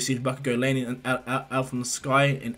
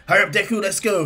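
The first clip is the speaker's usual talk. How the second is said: high, louder impatient urging; acted eager hurry-up call; 7 semitones higher, 7 dB louder than usual. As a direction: chivvy your friend into action. Rival urges friend to start.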